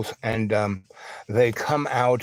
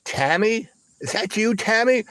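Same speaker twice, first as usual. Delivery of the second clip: high-pitched, disbelieving question; retold startled double-take; acted astonished recognition; 10 semitones higher than usual. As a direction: call out as if unsure it's really them. Acted stunned recognition of old acquaintance.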